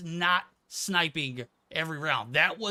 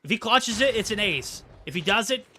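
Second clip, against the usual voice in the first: rising hype, louder and higher; excited; 6 semitones higher, 6 dB louder than usual. Real, not acted. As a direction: build the hype on the clutch. Clutch moment hype.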